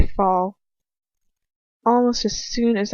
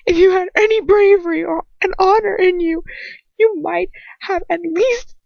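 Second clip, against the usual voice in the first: high, loud tearful screaming; screaming through tears; 10 semitones higher, 7 dB louder than usual. A narrator's reading. Girl screams her rage and tears at her captor. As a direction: let rage and tears spill out. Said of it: "spilling out of me in screams and tears".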